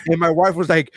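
ordinary talk

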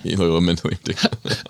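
flat, quiet, fast dead voice; stiff flat corpse mumble. Pretend. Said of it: "this is my impression of a corpse".

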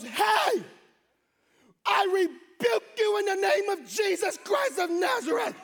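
loud, high full-force mock exorcism; hammed-up holy shout; shouted rebuke. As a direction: thunder it out like a crazy church mom. Mom confronts guard with rebuke.

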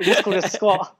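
laughing through the words. Shown as a demonstration. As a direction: repeat it while chuckling.

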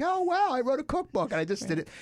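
high, mock-wowed at himself; hammed-up self-impressed wonder; mock amazement. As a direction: play up a giddy wow at his own cookbook. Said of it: "I'm like".

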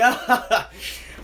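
high, loud imitation of dad laughing; imitated laughter. Son acting out dad's reaction to the acid confession, imitated laughter. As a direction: burst out laughing as the dad did. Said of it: "he just starts laughing".

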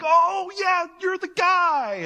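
hammed-up dawning awe; stunned realization. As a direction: let the penny drop, big and slow. Disciples finally recognize Jesus.

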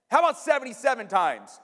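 high, loud counter-offer; spring the number on the listener; playful gotcha. Acted. Jesus springs the answer on Peter.

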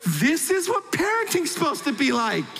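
high, sustained, almost sung mock elation; ecstatic exclamation. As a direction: act out a parent in raptures, holding it high. Acts out overjoyed parent.

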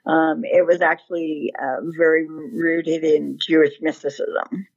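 ordinary talk, nothing marked

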